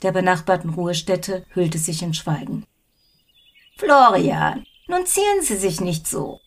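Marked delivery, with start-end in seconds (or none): 3.8-6.4 put-on a high, coaxing old lady; teasing old woman. A narrator's reading. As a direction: needle him with mock sweetness. Old woman teases neighbour.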